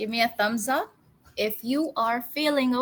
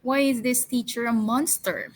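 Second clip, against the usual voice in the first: normal talking voice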